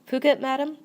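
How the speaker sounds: high, loud echo of Phuket, rising; surprised question. A narrator's reading. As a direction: query the destination in surprise. Maid echoes it as a question.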